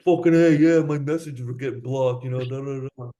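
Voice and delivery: brash New York guy; loud New York accent, swearing. Retold or imitated. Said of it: "this New York accent".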